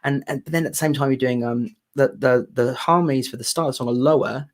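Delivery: ordinary talk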